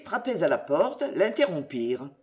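ordinary delivery

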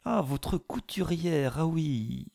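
high, slow, sugary insinuation; honeyed voice. A narrator's reading. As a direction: drip with mock sweetness. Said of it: "d'une voix mielleuse".

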